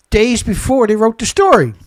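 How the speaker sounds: boiling over at the journalists; worked up. Real, not acted. Ranting about the journalists' pictures, heated and loud. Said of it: "Get myself all worked up".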